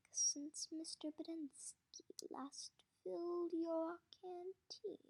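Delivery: the warden. Acted.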